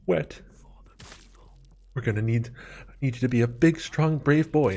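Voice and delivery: imitated mother coddling a little kid; babying mom. Mom talking to teen like an eight-year-old, babying voice. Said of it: "talking to me like I'm an eight-year-old".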